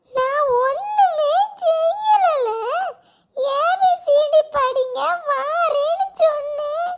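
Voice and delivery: slow, whining cry; tearful protest. Acted. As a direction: plead innocence in a drawn-out sob. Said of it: "எதுக்குமா அழுக".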